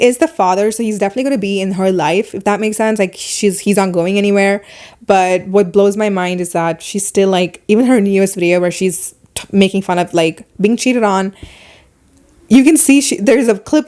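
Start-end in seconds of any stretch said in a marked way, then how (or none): none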